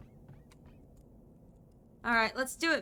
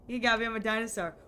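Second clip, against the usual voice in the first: ordinary talk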